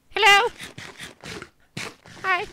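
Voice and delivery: high, loud greeting; calling out. Real, not acted. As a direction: call hello across the map. Greets a far player.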